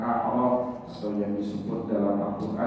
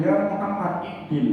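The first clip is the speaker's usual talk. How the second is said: high, raised imitation of a blunt summons; rude calling out; 8 semitones higher than usual. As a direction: mimic the Bedouin hailing the Prophet rudely. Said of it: "Muhammad itu dipanggil".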